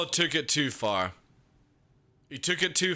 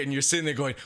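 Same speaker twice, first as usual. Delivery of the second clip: ordinary talk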